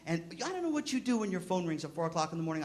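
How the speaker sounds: usual speaking voice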